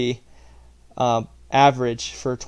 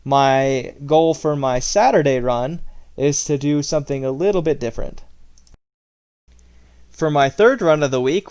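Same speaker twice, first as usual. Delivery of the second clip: usual speaking voice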